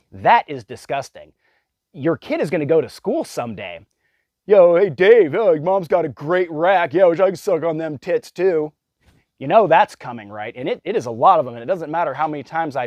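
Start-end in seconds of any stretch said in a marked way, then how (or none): 4.5-8.7 put-on much higher, much louder jeering teen voice; mocking schoolkid. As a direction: taunt him like a crude classmate in the hallway. Kid at school mocking a classmate.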